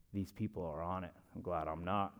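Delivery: low, very soft mock relief; hushed retold judgment; imitated private aside. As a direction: say it like a secret thought about other people. Audience's private thought, acted out.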